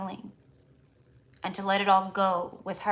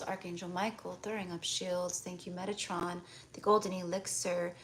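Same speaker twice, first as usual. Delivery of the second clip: channelled healing blessing; 2 semitones lower, 9 dB quieter than usual. Acted. Healer clearing a client's energy, soft meditative blessing. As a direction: murmur the invocations gently, breathing out between them.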